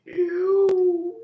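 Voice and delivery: high, eerie monster reply; creepy beast voice. Acted. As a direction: answer like a hungry beast. GM owlbear answers.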